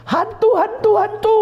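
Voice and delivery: high, panicked cry of ghost; frightened cry of ghost. Acted. Disciples in the storm crying out in fright. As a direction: shriek it like terrified disciples seeing a ghost. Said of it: "murid-muridnya teriak terkejut".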